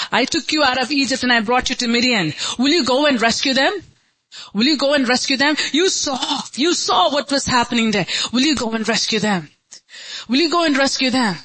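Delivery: high, urgent preacher's appeal; impassioned preaching. Acted. Preacher voicing God's call to rescue them, urgent appeal. Preaches as God, urging congregation.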